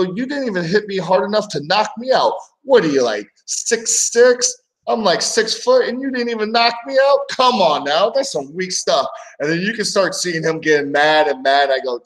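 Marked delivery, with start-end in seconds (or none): 0.0-9.1 higher, teasing put-down of a friend; mock taunting. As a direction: rib him like a weak puncher.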